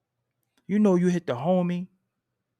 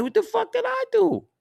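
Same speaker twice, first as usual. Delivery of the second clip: much higher, louder, disbelieving; high incredulous outburst; 4 dB louder than usual. Retold or imitated. To his old friend online, high stunned disbelief. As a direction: squeal it out in disbelief. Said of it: "I'm like".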